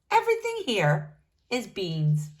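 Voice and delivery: usual speaking voice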